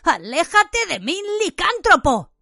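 high, alarmed cry; frightened shout, pitched well up; exclaimed recoil. A narrator's reading. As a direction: warn him off in horrified revulsion. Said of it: "Ron exclamó".

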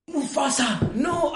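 high anguished mock wail of no; Simba's cry. Friend acts Simba's line from the musical, high mock wail. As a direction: cry out for the dying father, hammed up. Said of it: "también luego dice".

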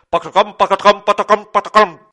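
loud, rhythmic clip-clop; imitated galloping hooves. A narrator's reading. Papá Noel imitating something in the hall, rhythmic gallop noise. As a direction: bounce the syllables like hoofbeats.

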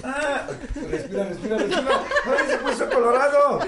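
breathless giggling shrieks; laughing. Real, not acted. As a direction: laugh out the words high. Laughing at the red face.

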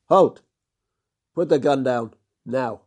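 loud, high command to halt; called out. Acted. Hendricks stops his partner from shooting, loud command. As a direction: shout him down from afar. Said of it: "a voice called out".

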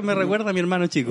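normal talking voice

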